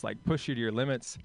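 ordinary talk, nothing marked

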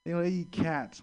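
ordinary talk, nothing marked

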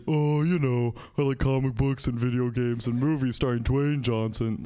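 same words, said plainly as if grown up; mock mature adult. Grown-up self listing favorites, mock mature. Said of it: "I'll say".

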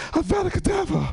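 high mock incantation; imitated kid cursing. Angry pupil cursing his teacher with wand, high mock menace. Kid casts killing curse.

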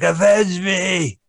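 slow, high, dying shout; overacted dying cry for revenge; overacted death scene. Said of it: "He's like".

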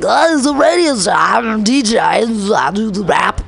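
mocking garbled drawl, words running together; rambling incoherent man. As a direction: imitate a man slurring his big claims. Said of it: "And he's like".

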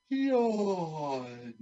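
bored fake yawn; mock yawn. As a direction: yawn it out lazily. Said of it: "This is my reaction".

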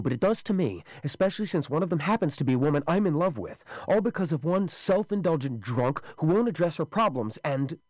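high, urgent, pleading voice; agitated pleading. Acted. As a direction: plead fast and rising.

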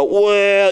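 ominously long "Well"; long drawn-out "Well". Retold or imitated. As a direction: draw out "Well" before a grim story. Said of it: "does, like, a long well".

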